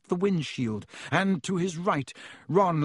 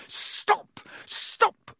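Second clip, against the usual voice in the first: two high, sharp yells. A narrator's reading. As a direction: yell each word as a burst. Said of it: "he yelled".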